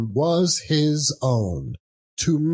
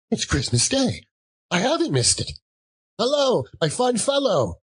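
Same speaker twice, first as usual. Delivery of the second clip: higher delighted relief; much higher giddy old man; overjoyed Scrooge; 11 semitones higher than usual. Acted. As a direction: rejoice that he hasn't missed it.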